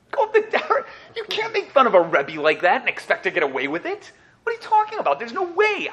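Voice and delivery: high, incredulous retort with a laugh; he scoffs at a worried bystander, high and incredulous; indignant. Retold or imitated. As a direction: scoff at the very idea. Retells confronting the critic.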